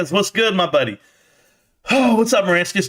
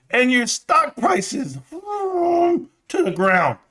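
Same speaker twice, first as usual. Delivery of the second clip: mock crying; 11 semitones higher, much slower than usual. Mock crying about stock.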